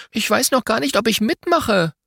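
put-on high-pitched reluctant child; storybook protest, pitched well up. A narrator's reading. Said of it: "Merle protestiert".

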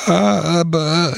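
high, drawn-out whine; jammernd. A narrator's reading. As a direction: wail it in protest, pitched high and falling. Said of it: "jammerte Binella". (hoch und gedehnt gejammert)

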